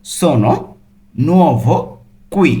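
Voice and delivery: slow, word-by-word Italian; separated word by word with pauses. Shown as a demonstration. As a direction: break each word apart for learners. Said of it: "ভেঙে ভেঙে অর্থ সহ বলছি".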